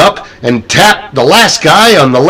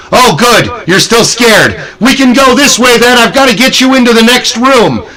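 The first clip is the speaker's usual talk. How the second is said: higher-pitched showman's welcome; cheery guide; 6 semitones higher than usual. Retold or imitated. Guide to scared guests entering the next room, cheery and climbing in pitch. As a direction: herd them along, pitch climbing.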